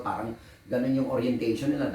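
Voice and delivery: usual speaking voice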